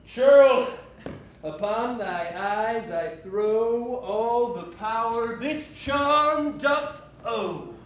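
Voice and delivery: spell casting. Acted. Lysander as Puck casting charm.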